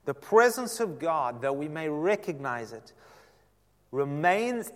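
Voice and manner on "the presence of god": usual speaking voice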